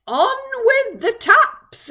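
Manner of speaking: high, loud drawn-out call from above; shout. A narrator's reading. Faraway voice calls down from the cloud, shouting. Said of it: "it shouted".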